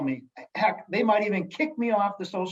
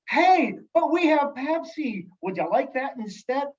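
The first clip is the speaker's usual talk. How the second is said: mock excited server; 8 semitones higher, 4 dB louder than usual. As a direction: ham up a falsely thrilled server offering Pepsi. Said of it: "no server is excited to say".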